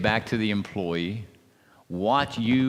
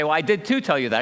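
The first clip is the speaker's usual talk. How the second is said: louder, higher insistent comeback; wife insisting she already told him, raised voice; 8 semitones higher, 6 dB louder than usual. Retold or imitated. Wife insisting in retold argument.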